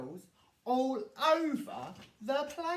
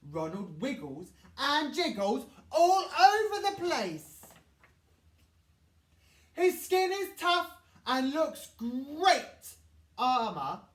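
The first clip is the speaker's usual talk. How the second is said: usual speaking voice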